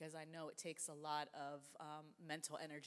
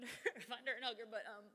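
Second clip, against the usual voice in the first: a laugh running through the words; laughing while talking; 7 semitones higher, 3 dB louder, faster than usual. Real, not acted. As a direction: talk through a giggle. Laughs while speaking, emotional.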